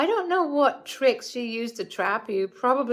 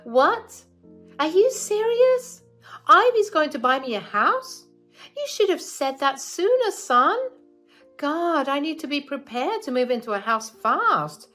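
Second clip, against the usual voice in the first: much higher, louder giddy delight; excited mother-in-law; 9 semitones higher, 6 dB louder than usual. Acted. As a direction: gush over the free house. Mother hears about the house.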